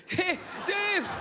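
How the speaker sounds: high excited call. Retold or imitated. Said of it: "I sound like Monica Lewinsky".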